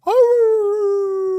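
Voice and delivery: very high squeaky yelp; mock high-pitched dog yelp; imitating dog's yelp. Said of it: "That's what he sounds like".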